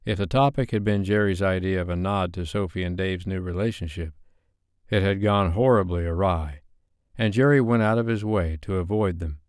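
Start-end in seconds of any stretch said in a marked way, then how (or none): none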